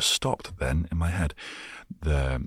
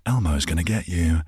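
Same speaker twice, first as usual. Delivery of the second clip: mock menace; 3 semitones higher, 5 dB louder than usual. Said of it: "in my voice, it would be like".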